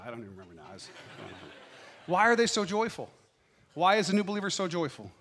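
ordinary talk

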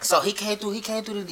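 usual speaking voice

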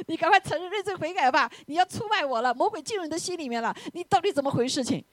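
high-pitched mock lecture to Judas; hammed-up high accusing tone; mock scolding. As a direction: act out urgent, judging reproach. Imagined Jesus scolding Judas.

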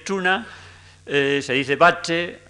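chuna and bache with ch sound, drawn out; slow ch in chuna and bache; western forms. Shown as a demonstration. Lecturer demonstrates western ch forms slowly.

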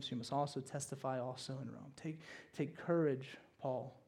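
very soft, low, unhurried comfort; hushed, intimate encouragement; gentle reassurance. Acted. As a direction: console quietly, letting each phrase settle. Voices Jesus comforting Paul alone.

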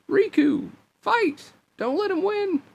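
very high, squeaky rallying cry; high-pitched king. A narrator's reading. King urging Riku to fight, in a very high character voice. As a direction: urge him on in a tiny high falsetto. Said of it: "Then he heard another voice".

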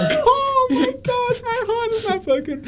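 laughing. Real, not acted. Laughing after buzzer bit.

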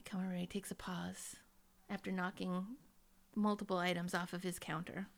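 hushed narration. A narrator's reading. Stage-direction narration.

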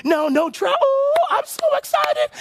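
very high mock squeal; excited. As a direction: squeal with hammed-up delight. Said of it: "You're excited about the whole deal".

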